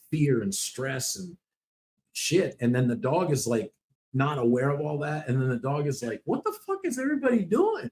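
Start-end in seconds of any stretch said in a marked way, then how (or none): 6.2-7.9 confused dog. Retold or imitated.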